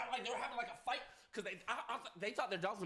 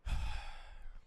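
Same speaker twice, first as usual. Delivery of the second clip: heavy sigh. Real, not acted. Sigh before continuing grim story.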